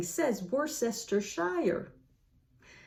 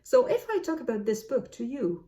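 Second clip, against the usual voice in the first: ordinary talk, nothing marked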